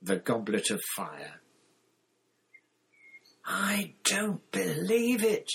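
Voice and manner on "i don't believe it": high, drawn-out stunned disbelief. A narrator's reading. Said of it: "said in a stunned voice".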